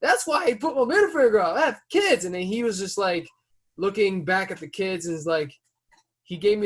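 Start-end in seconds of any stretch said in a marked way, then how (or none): 0.0-2.2 high, heated protest; yelling at driver. Retold or imitated. Father confronting the driver about his kids, high and heated. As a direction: shout it out, pitch way up. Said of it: "I said".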